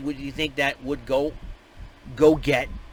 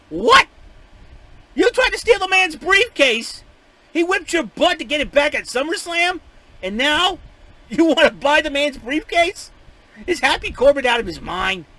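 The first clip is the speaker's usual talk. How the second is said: incredulous outburst; 11 semitones higher, 7 dB louder than usual. Real, not acted. Outraged at Corbin's briefcase offer.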